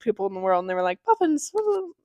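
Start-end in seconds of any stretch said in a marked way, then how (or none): 1.0-1.9 high, soft little cheer; mock delight. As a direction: imitate nice tourists cooing over puffins. Imitating delighted puffin watchers.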